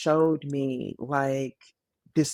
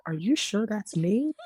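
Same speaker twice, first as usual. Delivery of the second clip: higher-pitched, mock disbelief; disbelieving question; 8 semitones higher than usual. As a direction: retell it as an incredulous question. Retells her disbelieving question to Emily.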